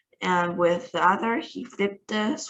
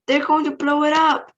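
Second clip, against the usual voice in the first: a much higher, louder alarmed yell; panicked yell; 8 semitones higher, 5 dB louder than usual. A narrator's reading. Ground control fearing for the hotel, yelling in panic. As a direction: raise the alarm at full cry. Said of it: "yelled ground control".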